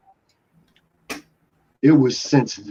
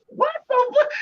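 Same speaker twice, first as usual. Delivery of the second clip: much higher, stunned what the fuck; shocked disbelief. Retold or imitated. Recognising Big Baby behind the counter, high-pitched shock. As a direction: squeak it out in pure disbelief. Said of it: "I'm like".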